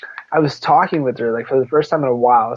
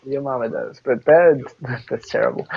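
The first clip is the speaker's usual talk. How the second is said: ordinary talk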